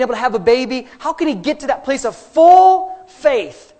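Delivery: high, loud, slow shouted preaching; preaching at full force. Acted. Pastor urging the congregation to faith, shouting. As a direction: drive every phrase home to the crowd.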